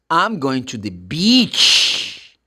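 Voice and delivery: slow line with a long, held ee in "beach". Shown as a demonstration. Teacher models the beach sentence for learners, long-E beach. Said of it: "make the sound longer".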